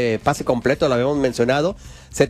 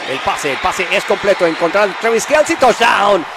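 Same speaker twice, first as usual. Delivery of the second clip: screamed touchdown call, much higher and louder; excited play-by-play; 8 semitones higher, 6 dB louder than usual. Real, not acted. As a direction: explode on touchdown, hold it. Narra en vivo el touchdown. (grito eufórico de touchdown)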